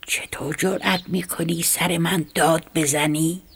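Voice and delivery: ordinary delivery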